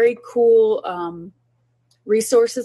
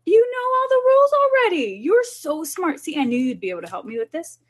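put-on praising the kids; 9 semitones higher than usual. Shown as a demonstration. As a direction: gush over how smart they are. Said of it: "And you say".

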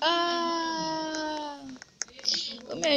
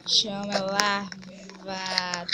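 drawn-out title; 5 semitones lower, 4 dB quieter, much slower than usual. Acted. Announcing the game's name.

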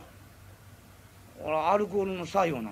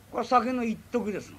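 ordinary talk, nothing marked

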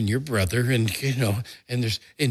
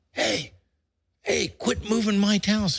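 1 neighbor yelling; 12 semitones higher than usual. Retold or imitated. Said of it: "all of a sudden I hear".

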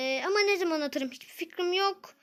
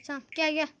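ordinary talk

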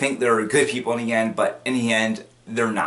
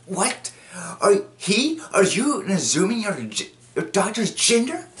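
high, offended stammer; mock getting mad; 8 semitones higher than usual. He objects about the doctor's gender, mock mad. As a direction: act affronted in a raised voice. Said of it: "he's just getting all mad".

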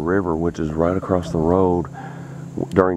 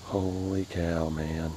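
slow, hushed amazement; awed; 10 dB quieter, slower than usual. Real, not acted. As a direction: let it out slowly in awe. Awed at the crappie.

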